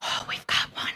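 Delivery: breathy whisper, no voice at all; excited whisper. Retold or imitated. Medium whispering to the circle, thrilled a spirit came. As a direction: whisper it like the séance just worked. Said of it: "And she's like".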